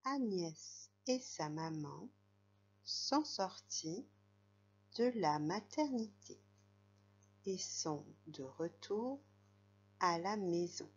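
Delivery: put-on slow, careful read-aloud for a child. Shown as a demonstration. Teacher reading the story to a young pupil, slowly. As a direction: read each phrase slowly and clearly. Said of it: "pour moi, je vais effacer".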